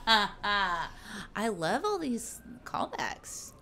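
slow, voiceless wheeze through laughter; breathless with laughter. Real, not acted. Co-host delighted by the running jokes, laughing breathlessly. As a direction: squeeze the words out while laughing.

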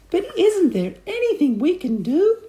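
high, loud desperate plea; hammed-up frantic wife; crying out. A narrator's reading. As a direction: plead in a high panicked voice. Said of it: "cried Missus Twit".

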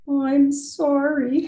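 high, slow, drawn-out apology; tearful apology. A narrator's reading. Harriet to her mother after the yelling, tearful apology. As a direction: plead it high, like an upset child. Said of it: "Harriet cried".

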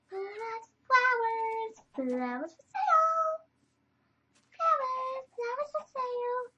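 high, sing-song call of a flower seller; street vendor call. Pretend. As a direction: call out to passers-by.

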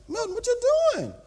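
high, quick worried question; voice getting high. Acted. Winded pastor asking his trainer what he's doing, voice rising high. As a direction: voice starting to climb in alarm. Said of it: "My voice starts getting high".

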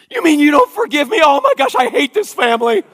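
put-on high, loud wailing teen; whiny daughter outburst. Dad mimics daughter storming off, high loud wail. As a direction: shriek it like a wounded teen.

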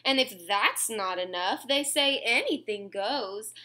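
ordinary delivery, nothing marked

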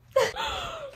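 sudden high burst of laughter; a quick falling giggle. Real, not acted. Laughs at her own joke.

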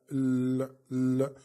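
ordinary delivery

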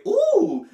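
high squealed ooh; high falsetto ooh; mimicked ad-lib. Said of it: "he give you a chance to say".

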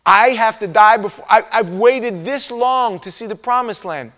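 anguished protest. Retold or imitated. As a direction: argue with God you waited so long. Moses protesting his death.

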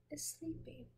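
soft whispered ending. Pretend. Ending the refrain for the child, whispered. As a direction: let it fade into a whisper.

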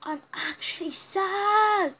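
slow, tearful and drawn out; really sad. Real, not acted. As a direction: let the sadness drag the words. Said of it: "As you can tell by my voice".

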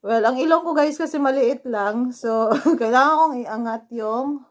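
hands pressing at nose; speaking while adjusting mask; talking in sheet mask. Real, not acted. Seen 0: Fingers press mask at nose; lips stay visible, mask covers face.

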